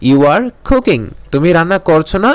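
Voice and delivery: normal talking voice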